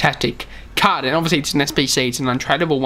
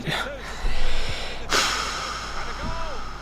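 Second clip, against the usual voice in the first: drawn-out breathy sigh; exhausted exhale; long weary sigh; 10 semitones higher, 6 dB quieter than usual. Real, not acted. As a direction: let out a tired sigh. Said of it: "I'm just so tired at the moment".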